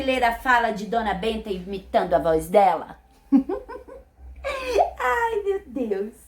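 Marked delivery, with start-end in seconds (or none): 3.3-6.1 laughing. Real, not acted. Laughing at the question.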